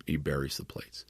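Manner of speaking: hushed breathy whisper, no voice at all; a whisper that trails off into silence. Acted. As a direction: drop to a secretive whisper as if hiding something. Moroni burying the plates alone.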